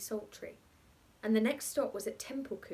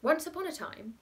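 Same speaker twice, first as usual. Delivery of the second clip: storytelling opening; 8 semitones higher, 3 dB louder than usual. Acted. Bachelor begins story.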